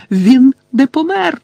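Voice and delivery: high, loud, drawn-out denial; anguished high-pitched shout; roaring. A narrator's reading. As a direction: roar it in desperate refusal. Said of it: "заревів Гаррі".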